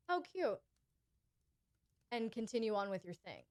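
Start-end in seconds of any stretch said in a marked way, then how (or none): 0.1-0.6 flat how cute. Shown as a demonstration. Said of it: "That's not the time for you to be like".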